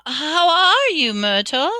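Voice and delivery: high, loud, stretched fake cheer; falsely bright. A narrator's reading. Hermione covering up to a ghost, falsely bright. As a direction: greet her with forced brightness. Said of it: "in a falsely bright voice".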